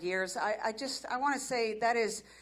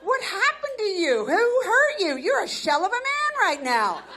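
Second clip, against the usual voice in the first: shocked disbelief; 5 semitones higher, 4 dB louder than usual. Retold or imitated. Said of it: "I'm like".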